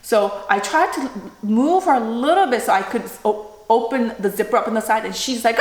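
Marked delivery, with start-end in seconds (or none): none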